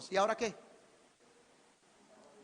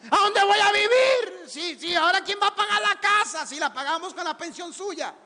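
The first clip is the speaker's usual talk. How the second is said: higher, louder desperate wailing; mock crying shout; 7 semitones higher, 5 dB louder than usual. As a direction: howl like a son losing his meal ticket. Selfish son cries at funeral.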